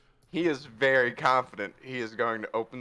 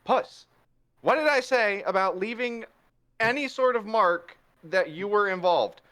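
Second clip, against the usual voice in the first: higher, louder reproachful voice; scolding Rivera; 7 semitones higher, 4 dB louder than usual. Acted. Rivera scolding Puss for leaving marks, higher reproach. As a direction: tell him off like an exasperated partner. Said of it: "Rivera's like".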